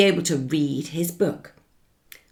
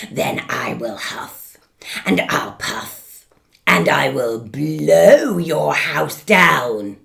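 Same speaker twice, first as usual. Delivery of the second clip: menacing wolf; 2 semitones lower, 8 dB louder, slower than usual. Acted. Wolf threatens.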